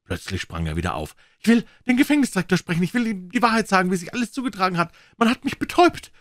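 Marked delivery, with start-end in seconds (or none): 1.4-6.1 very high, loud, fast frantic demand; high, hurried panicked pleading. A narrator's reading. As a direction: rush it out in desperation. Prisoner demands the director.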